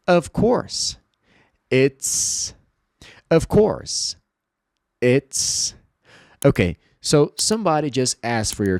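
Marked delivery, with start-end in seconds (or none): 0.1-1.0 bright, drawn-out Of course said high; clear model phrase. Shown as a demonstration. Teacher modeling a phrase to repeat, clear and high. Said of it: "The first one". 3.3-4.2 bright, drawn-out Of course said high; clear model phrase. Shown as a demonstration. Teacher modeling a phrase to repeat, clear and high. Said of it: "The first one".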